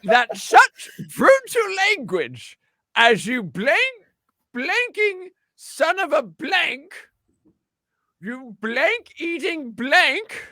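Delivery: put-on high, slow, old-fashioned orator; old-timey voice. Shown as a demonstration. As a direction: drag out each blank. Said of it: "I'm gonna put on my best old-timey voice for you".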